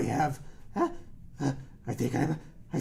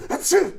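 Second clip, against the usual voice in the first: sneeze; 12 semitones higher, 9 dB louder than usual. Pretend. Dad pretends to keep sneezing.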